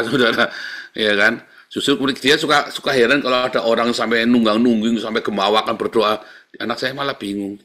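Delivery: ordinary talk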